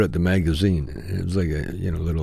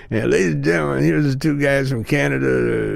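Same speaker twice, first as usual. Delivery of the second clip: emcee intro; 6 semitones higher than usual. Retold or imitated. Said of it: "she was M C ing".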